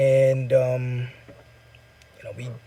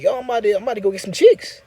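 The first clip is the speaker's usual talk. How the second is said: eager retold reply; 6 semitones higher, faster than usual. Retelling his excited reply in dream.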